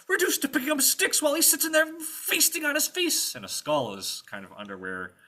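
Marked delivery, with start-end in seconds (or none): 0.1-3.3 high, loud indignant grumbling; ranting squire. Acted. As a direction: rant bitterly as the wronged servant. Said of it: "he keeps on ranting".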